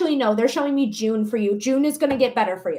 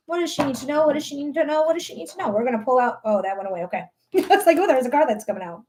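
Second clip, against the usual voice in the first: usual speaking voice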